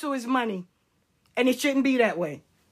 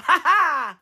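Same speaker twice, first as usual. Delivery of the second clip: mocking laugh; 3 semitones higher, 3 dB louder than usual. Real, not acted. Laughing at critics.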